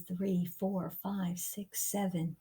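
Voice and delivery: ordinary delivery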